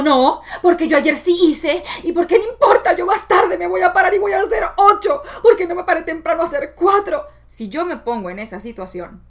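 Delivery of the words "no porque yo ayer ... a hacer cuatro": high, loud, indignant retort with a laugh; defensive pushback. Retold or imitated. Arguing back at her critical voice, loud and indignant. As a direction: fight back against the inner voice. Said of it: "Si yo empiezo".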